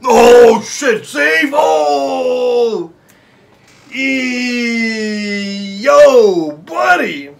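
loud high yell; excited shouting. Real, not acted. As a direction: cheer the save. Big save in match.

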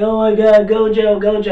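high, loud mock fangirl gushing; starstruck fangirl. Assistant fawns over Gojo, starstruck. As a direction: gush his name like a smitten fan.

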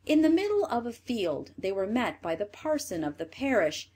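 ordinary delivery

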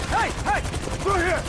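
shouting in a gunfight. Acted. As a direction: yell over the gunfire. Shootout escape, calling out.

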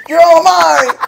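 very loud, high, laughing yell; mock possessive shout. Joking with friend about claiming her, mock possessive shout. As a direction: bellow it slowly, playing greedy.

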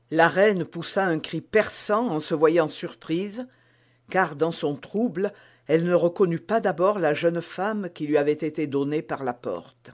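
usual speaking voice

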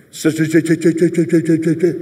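repeating so, so, so stammer; imitating stutter. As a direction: stammer like the flustered teammate. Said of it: "And Franco".

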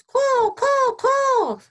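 high, loud caws; piercing bird squawks; squawking jackdaw. A narrator's reading. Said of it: "squawked Jackdaw".

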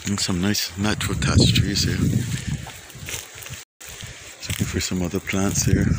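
usual speaking voice

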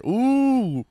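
high mocking "ooh"; loud teasing ooh. Real, not acted. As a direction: tease with a sing-song ooh. Teasing about liking a girl.

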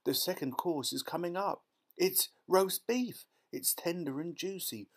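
high, rapturous relishing; excited child marvelling at a feast; thrilled girl. A narrator's reading. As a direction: savour every mouthful with delight. Violet marvels at the magic meal.

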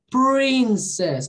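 PRINCESS drawn out on a curled R; slow "princess", holding the R after P; English R. Shown as a demonstration. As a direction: stretch the retroflex R in "princess". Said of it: "Tem um R aqui".

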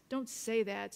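usual speaking voice

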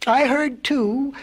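high, drawn-out mild yell; crow's yell. A narrator's reading. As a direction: call out slowly as a crow. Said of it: "yelled a crow".

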